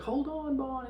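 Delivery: hammed-up high-pitched call for help; imitated woman calling out. Acting out Holly calling to Bond.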